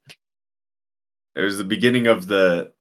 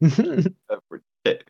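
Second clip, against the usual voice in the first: teasing echo; 10 semitones higher, slower than usual. Pretend.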